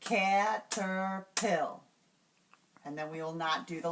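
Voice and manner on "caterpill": CATERPILL in three slow, separated beats; syllable by syllable. Shown as a demonstration. Teacher showing the first three syllables, slowly separated. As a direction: break Caterpill into cat, er, pill, slowly. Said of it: "only going to do the first three syllables".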